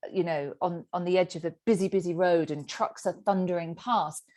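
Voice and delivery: ordinary talk, nothing marked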